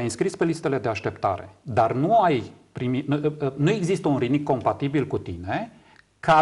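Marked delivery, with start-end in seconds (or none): none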